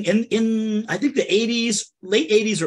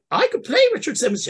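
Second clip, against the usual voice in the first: Tilda Swinton; 7 semitones higher than usual. Acted.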